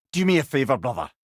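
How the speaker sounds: ordinary talk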